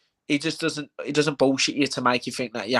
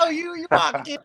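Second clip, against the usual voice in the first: much higher, exaggerated Manchester accent; mock Manc accent. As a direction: squeal it in a hammed-up Manc voice. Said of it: "I'll just start talking Manc".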